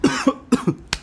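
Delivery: sudden choking cough; coughing on a sip of coffee; choking on a drink. Real, not acted. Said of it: "me atraganté con el café".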